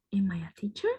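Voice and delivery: unvoiced, unsure whisper to yourself; doubtful whisper. Teacher doubting she is a teacher, whispering. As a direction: ask it as if you can't believe you're a teacher. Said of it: "Si no soy consciente de que soy maestra".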